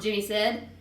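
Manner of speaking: usual speaking voice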